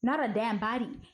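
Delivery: ordinary talk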